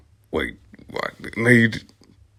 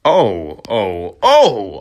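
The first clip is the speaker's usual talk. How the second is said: mock scream; 7 dB louder, much slower than usual. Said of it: "I screamed".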